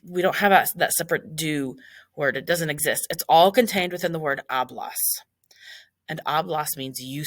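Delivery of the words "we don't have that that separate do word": ordinary delivery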